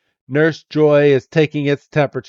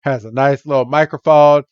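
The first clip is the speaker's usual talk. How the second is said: ordinary delivery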